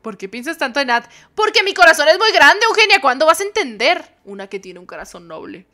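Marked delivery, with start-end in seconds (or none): 1.3-4.0 high, loud melodramatic declaration; dramatic diva. Acted. Friend scolding Eugenia, loud melodramatic declaration. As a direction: declare it with soap-opera drama.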